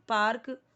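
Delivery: पार्क with a hard spoken r; loud पार्क, r sounded; wrong pronunciation. Shown as a demonstration. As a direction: say पार्क as "paark", stressing the r. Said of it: "पार्क नहीं".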